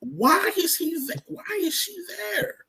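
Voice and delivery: high-pitched, drawn-out exasperated questioning; exasperated disbelief. Real, not acted. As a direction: stretch it out high, baffled and fed up. Exasperated at her being there.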